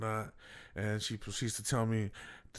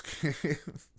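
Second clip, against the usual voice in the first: normal talking voice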